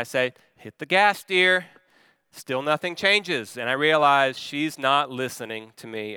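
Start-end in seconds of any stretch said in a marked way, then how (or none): 0.6-1.7 firmer, higher-pitched urging; raised voice, a bit more insistent; slightly ramped up. Retold or imitated. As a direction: push it a little harder, still controlled. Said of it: "So I ramp up my response just a little bit".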